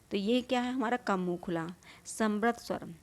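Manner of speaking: ordinary talk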